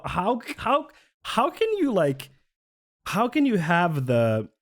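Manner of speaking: how can you, rising to a squeak; incredulous. Real, not acted. Streamer ranting at Miller, squeaky disbelief. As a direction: voice jumps high in disbelief.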